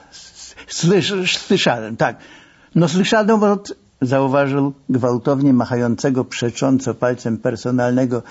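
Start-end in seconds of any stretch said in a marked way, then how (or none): none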